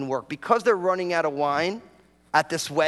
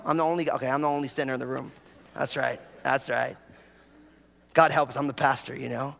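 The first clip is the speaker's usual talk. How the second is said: ordinary talk, nothing marked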